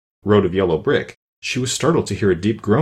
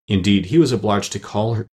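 usual speaking voice

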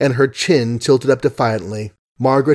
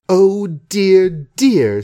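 higher, louder, very slow exasperated oh dear; a drawn-out vexed young woman; vexed sigh; 8 semitones higher, 4 dB louder, much slower than usual. A narrator's reading. Margaret alone after Hugh leaves, exasperated. Margaret among her papers.

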